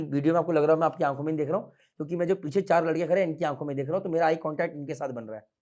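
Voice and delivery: normal talking voice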